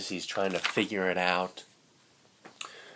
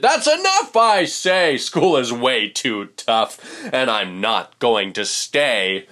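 loud huffy declaration; 7 dB louder than usual. Acted. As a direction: storm off shouting. Fish storms out of school.

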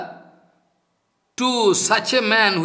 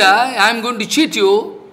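usual speaking voice

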